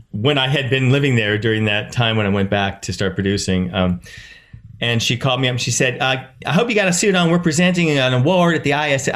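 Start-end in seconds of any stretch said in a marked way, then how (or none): 6.5-9.2 higher-pitched put-on accent; retelling in a put-on accent; Patrika Darbo's accent. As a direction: imitate a colleague's accent, pitched up. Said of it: "I don't know why I always do this accent with her".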